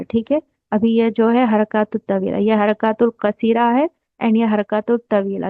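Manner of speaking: ordinary talk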